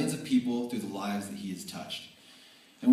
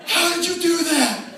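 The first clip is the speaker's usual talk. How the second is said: much higher and louder, laughing; amazed question; 11 dB louder than usual. Retold or imitated. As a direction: demand it in delighted astonishment. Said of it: "he's like".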